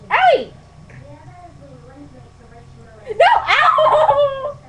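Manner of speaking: sudden high yelp of pain. Real, not acted. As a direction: yelp from the bite. Bitten again.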